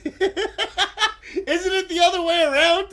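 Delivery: laughing so hard the words squeak up high; slow, breaking into giggles mid-question. Real, not acted. Teased by friends, asking through helpless laughter. Laughing disbelief at being taught.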